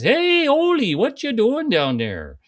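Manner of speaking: high, hailing Minnesota call; Sven's Minnesota accent. Acted. Friend hailing Ole from afar, Minnesota accent. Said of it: "they literally had the thick Minnesota accents".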